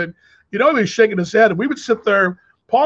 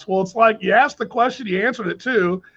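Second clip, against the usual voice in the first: ordinary talk, nothing marked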